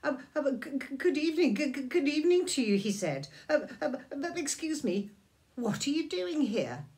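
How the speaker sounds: high, loud stammering faun voice; nervous faun greeting Lucy; flustered faun. Acted. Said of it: "The fawn looked at her in amazing".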